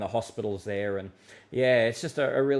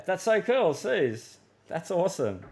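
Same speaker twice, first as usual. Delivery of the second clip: delighted; 7 semitones higher than usual. Real, not acted. Host praising his old workmate Suze in chat, delighted. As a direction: gush over a friend's news.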